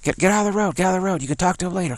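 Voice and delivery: high-pitched imitation of a policeman yelling; mock-yelled order to clear the road; retold yelling. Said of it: "yelling real strong at me".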